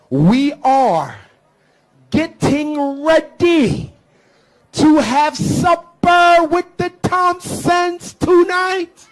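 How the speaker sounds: mock the stiff, proper way they talk, drawn out.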